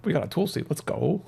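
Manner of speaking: stunned, then thrilled; real surprise at a rare drop; shocked excitement. Real, not acted.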